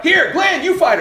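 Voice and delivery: high, loud, laughing call; eager students. Retold or imitated. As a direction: students pushing Glenn into the debate. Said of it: "they're like".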